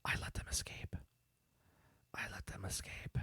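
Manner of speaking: breathy whispered confession; whispered guilty admission under her breath. Retold or imitated. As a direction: say it under your breath, barely voiced. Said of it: "she says underneath her breath".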